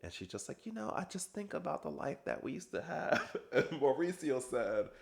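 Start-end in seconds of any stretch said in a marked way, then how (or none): 0.7-3.3 high, soft, imitating a weepy wife; a hint of the crying wife, mildly mocking; softly mimicking a sad sigh of regret; mock tearful Kyle. Kyle crying over old life.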